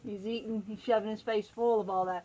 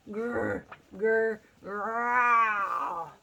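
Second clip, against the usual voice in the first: growling noise; 4 dB louder, much slower than usual. Acted. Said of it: "What could that awful noise be".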